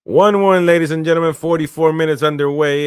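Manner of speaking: high, loud hype announcement; excited announcer call. Real, not acted. Streamer hyping the one-one score to viewers, loud announcer call.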